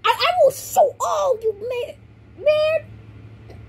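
high, slow hammy villain rant. Acted. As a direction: rage like a cartoon villain.

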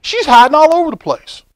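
high, lively aside; excited. Real, not acted. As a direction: point it out eagerly. Excited aside about picture.

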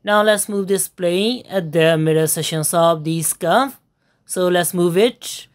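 ordinary talk, nothing marked

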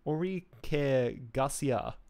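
slow, careful French accent. Shown as a demonstration. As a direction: say the name the French way. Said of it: "my terrible French accent".

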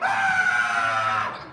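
long, loud staged scream; mock scream. Wife screams while Joseph looks on, staged scream. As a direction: scream it out and hold it. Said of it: "And then she screamed".

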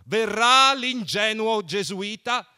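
high, loud, slow teasing shout; laughing, taunting shout. A narrator's reading. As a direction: yell the mocking question out. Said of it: "gridò".

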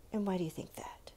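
quick hushed whisper. Real, not acted. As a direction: whisper the question, a bit fast. Teacher whispers follow-up question.

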